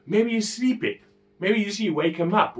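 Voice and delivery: high-pitched mocking taunt; retold jeer, pitched well up; Elijah. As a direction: tease them with fake helpful suggestions. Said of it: "Elijah starts taunting them".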